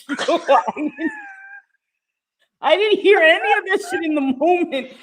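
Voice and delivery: 3 protest while cracking up; 1 laughing protest. Retold or imitated. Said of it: "I'm like".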